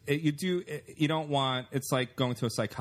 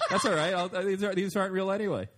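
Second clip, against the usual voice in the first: laughing mock reassurance; 8 semitones higher than usual. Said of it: "I'll be like".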